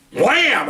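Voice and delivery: sudden high-pitched "wham"; imitated impact. Officer fires the taser at him, explosive imitation. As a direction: punch out the sound of the hit.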